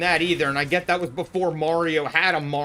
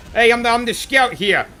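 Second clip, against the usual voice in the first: loud, high, bad impression of a game mercenary; Scout; 7 semitones higher, 9 dB louder than usual. Streamer doing rapid-fire impressions, loud Scout voice. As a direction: call it out high in a put-on voice. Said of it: "Here are my shitty impressions, rapid fire".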